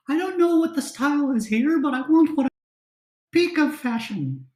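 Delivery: high, fast refined lady voice; high elf lady voice. Acted. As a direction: sound like a fashionable noblewoman.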